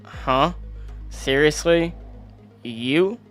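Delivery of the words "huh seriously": ordinary talk, nothing marked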